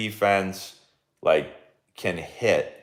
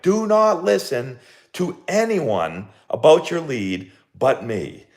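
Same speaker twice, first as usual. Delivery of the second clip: high, slow, emphatic mock holler; retold scream; 8 semitones higher, 4 dB louder, slower than usual. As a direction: re-enact calling out each word slowly to players. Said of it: "I just had to scream out loud".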